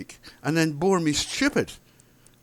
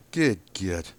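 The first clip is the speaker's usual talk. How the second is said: mock English accent; 5 semitones lower than usual. Man mocking a bore's threeping, in an English accent. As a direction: mimic a horrible English accent, lower. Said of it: "in this horrible English accent".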